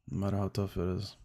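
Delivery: ordinary delivery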